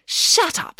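high, sharp silencing; annoyed snap. A narrator's reading. April snaps at her brother.

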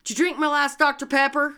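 loud, high, fast accusation; mock accusing.

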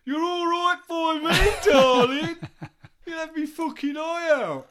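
[name] impression. [name] shouting at the groupie video, high and loud. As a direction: holler it like a wasted rock star. Voicing [name]'s reply to tape.

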